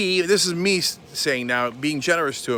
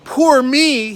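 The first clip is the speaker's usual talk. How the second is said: high, loud whiny poor me; mock crying whine; 6 semitones higher, 8 dB louder than usual. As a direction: whimper it like a crybaby. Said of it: "He's crying".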